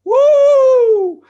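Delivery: very high, loud signature whoop; Ric Flair woo. Retold or imitated. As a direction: belt out a wrestler's woo. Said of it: "in his shirt and tie and suit".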